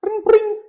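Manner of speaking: high ringing phone noise; mimicked phone ring. Starting a pretend call to his friend, mimicked ring. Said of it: "so I hit the, how you hit the".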